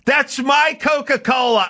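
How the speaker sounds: high, shouted possessive protest; indignant stranger's voice. Retold or imitated. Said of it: "I hear this voice".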